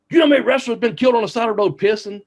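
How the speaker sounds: high, fast, hammed-up rant in Neidhart's voice; Neidhart's warning. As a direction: ham up the wrestler scolding the bus, high and quick. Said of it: "and he's going".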